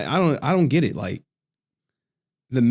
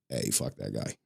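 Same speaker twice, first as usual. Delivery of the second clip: much lower, quieter dismissal; low dismissive aside; 13 dB quieter than usual. Retold or imitated. Streamer answering chat about a rival, low and dry. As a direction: toss it off under your breath. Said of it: "I'd be like".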